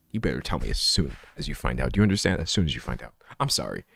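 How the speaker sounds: low, quiet mock threat; mock-stern warning. Streamer warns friends who skip functions, low and quietly stern. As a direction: drop low and soft, laying down the law.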